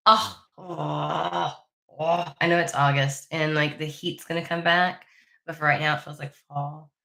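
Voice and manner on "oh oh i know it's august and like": usual speaking voice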